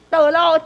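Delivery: 道路 hit high and loud; emphatic. Shown as a demonstration. Teacher demonstrating where to add emphasis, stressed 道路. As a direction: punch the word 道路. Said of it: "加强伫遐".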